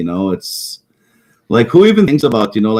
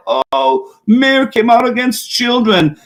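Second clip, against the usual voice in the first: mock whine; 10 semitones higher, much slower than usual. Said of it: "and then you cry".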